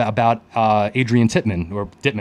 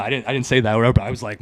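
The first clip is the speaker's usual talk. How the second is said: ordinary delivery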